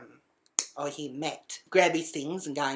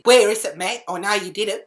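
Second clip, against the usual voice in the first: loud, raised interrogation; accusing demand; 5 semitones higher, 10 dB louder than usual. Retold or imitated. Bursting in on the housemate, loud accusing demand. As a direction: demand it as if certain of guilt.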